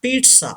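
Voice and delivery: "पिज़्ज़ा" said as peet-sa; model pronunciation. Shown as a demonstration. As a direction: say "पिज़्ज़ा" with a ts in the middle. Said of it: "Say this word". ("पिज़्ज़ा" को "पीट्सा" बोलें)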